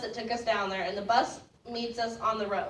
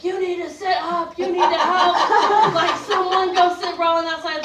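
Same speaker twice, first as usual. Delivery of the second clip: loud, high commands over the river noise; a guide yelling at his rafters; shouting guide; 7 semitones higher, 9 dB louder than usual. Retold or imitated. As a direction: bark orders at the raft crew. Guide barking at the crew.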